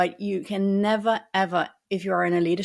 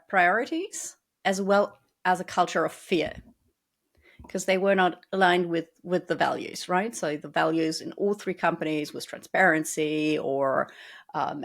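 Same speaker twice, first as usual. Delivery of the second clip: ordinary talk, nothing marked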